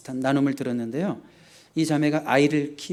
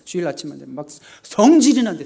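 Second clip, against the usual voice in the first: usual speaking voice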